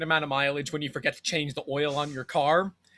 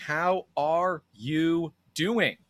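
ordinary delivery

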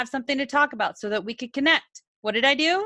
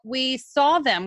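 ordinary talk

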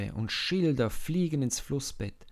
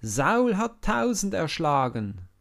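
loud, higher cheering call; crowd calling; 8 semitones higher, 8 dB louder than usual. Acted. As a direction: call it out in praise. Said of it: "und rufen".

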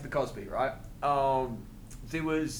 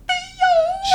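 very high, loud drawn-out cry; Cosby; 6 dB louder than usual. Acted. Comic showing girlfriend's halfway-through interruption, high loud Cosby cry. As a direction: blurt the name in a squealed sitcom dad impersonation. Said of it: "Is this, is this a good Cosby".

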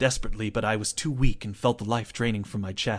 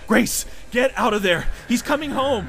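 urgent shout; 13 semitones higher, 4 dB louder than usual. Acted. Narrator yelling warning.

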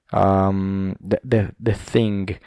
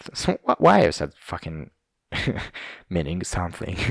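ordinary talk

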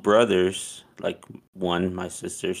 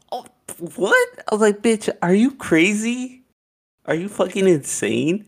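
shocked outburst; 12 semitones higher than usual. Retold or imitated. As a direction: explode in disbelief.

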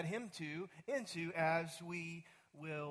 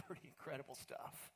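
awed whisper; 3 semitones lower, 12 dB quieter than usual. Real, not acted. Awed aside after the scripture.

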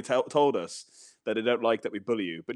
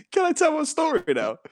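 laughing plea; 4 dB louder than usual. Real, not acted.

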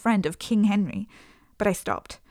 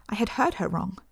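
plain reading voice

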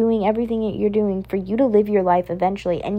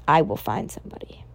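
whisper; 7 semitones lower, 5 dB quieter than usual. Acted. Same promise repeated, now whispered.

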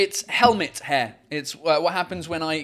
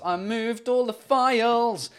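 higher, drawn-out lament; exasperated lament; 6 semitones higher, slower than usual. Real, not acted. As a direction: stretch it out in exasperation at yourself. Repeats it as a lament.

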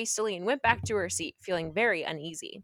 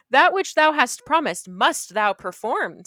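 stern king; 3 semitones higher, 8 dB louder, slower than usual. Acted. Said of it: "said the king".

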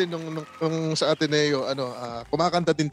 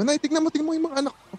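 much higher, fast proud call to his wife; dad proudly calling his wife over, high and quick; father calling out proudly; 13 semitones higher, faster than usual. Retold or imitated. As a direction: call out excitedly to mom to come see. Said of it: "Sabi niya, tawag niya".

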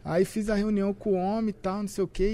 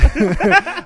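high, loud burst of laughter; 12 semitones higher, 14 dB louder than usual. Real, not acted. Guest hearing host's love message for Ronaldo, loud laughter. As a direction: crack up loudly.

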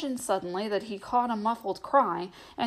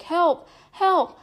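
high, loud desperate cry; frightened cries from the dark; cry for help; 9 semitones higher, 6 dB louder than usual. A narrator's reading. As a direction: scream for help in terror. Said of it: "often repeated".

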